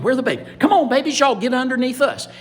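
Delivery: high, loud, urgent calling; anxious gator mamas. Acted. Gator mamas calling babies away from fire, high and urgent. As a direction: call the little ones in urgently. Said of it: "And all the mamas".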